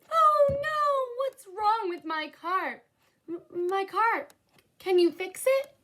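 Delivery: high, slow, upset cry over the car; distressed. Acted. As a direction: plead in dismay about the broken car. Viola upset about broken car.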